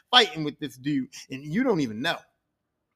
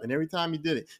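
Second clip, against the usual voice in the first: plain reading voice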